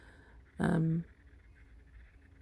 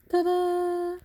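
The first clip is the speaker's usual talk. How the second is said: mock fanfare; 13 semitones higher, 6 dB louder than usual. Reveals another tomato.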